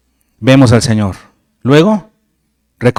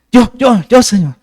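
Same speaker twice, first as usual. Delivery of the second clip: much higher, jumping-up-and-down eagerness; like a keen pupil begging to be picked; high-pitched eager yo yo yo; eager volunteering; 9 semitones higher than usual. Pretend. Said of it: "me lo imagino así saltando".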